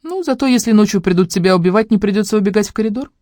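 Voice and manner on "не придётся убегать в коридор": ordinary delivery, nothing marked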